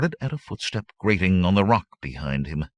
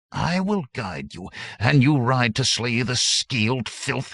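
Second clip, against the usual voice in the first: higher, spat-out vow; 8 semitones higher, 3 dB louder than usual. Acted. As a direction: spit the words with hatred. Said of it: "he spat".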